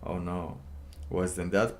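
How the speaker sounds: ordinary talk, nothing marked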